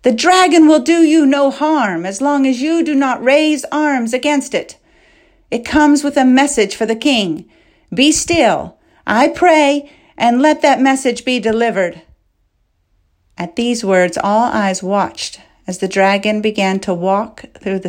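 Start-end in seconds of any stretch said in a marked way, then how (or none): 0.0-12.0 high, sustained proclamation; addressing a crowd. A narrator's reading. Princess reassuring soldiers about the dragon, proclaiming. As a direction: proclaim it to an army. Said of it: "they turned to hear her speak".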